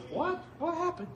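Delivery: high, incredulous what; mock surprise. Preacher feigning shock at the story turn, high surprise. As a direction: act stunned by the twist. Preacher reacts to Elijah fleeing.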